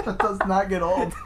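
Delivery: high, laughing through words. Real, not acted. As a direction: laugh while saying it. Laughing hard.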